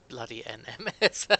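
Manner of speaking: laughing through the words, rising at the end. Real, not acted. Laughing at a chat joke.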